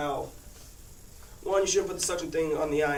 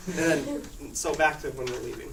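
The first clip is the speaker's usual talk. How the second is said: ordinary talk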